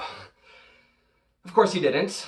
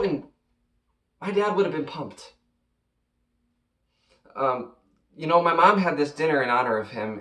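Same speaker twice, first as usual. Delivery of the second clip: ordinary talk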